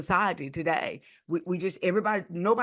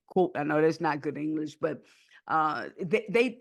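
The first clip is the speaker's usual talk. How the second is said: usual speaking voice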